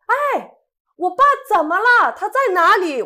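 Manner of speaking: high, loud frightened shout; anxious shout. Retold or imitated. Scared child yelling at the driver from the back seat, loud and high. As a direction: yell the question over the engine noise. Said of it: "我就喊".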